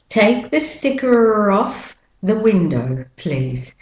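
British-Australian linking R in "sticker off"; British-Australian model sentence; British-Australian accent. Shown as a demonstration. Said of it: "British, Australian R".

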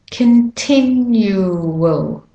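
continual very slowly, syllable by syllable; slow breakdown. Shown as a demonstration. Teacher breaking the word down for learners, very slow continual. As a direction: stretch continual out, stressing TIN. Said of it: "And tin is the stressed syl".

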